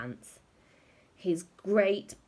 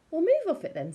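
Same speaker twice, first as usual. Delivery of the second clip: dismissive wife; 10 semitones higher, 6 dB louder than usual. A narrator's reading. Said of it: "still looking at the screen".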